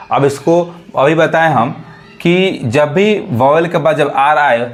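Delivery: ordinary talk, nothing marked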